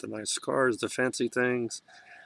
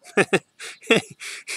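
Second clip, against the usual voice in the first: rueful laugh; 10 semitones higher, 6 dB louder than usual. Real, not acted. Laughs at unforgiving people.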